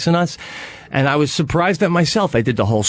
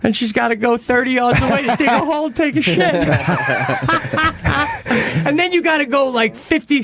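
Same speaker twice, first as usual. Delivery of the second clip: high, excited storytelling about his wife; excited gushing; 8 semitones higher than usual. Real, not acted. Man tells friends about his wife, excited and high. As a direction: gush about the wife, pitch way up.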